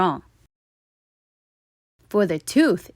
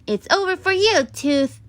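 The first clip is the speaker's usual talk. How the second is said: defiant announcement; 11 semitones higher than usual. A narrator's reading. Stuart announces victory over the tooth, defiant. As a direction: declare victory with the carrot. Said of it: "announced Stuart".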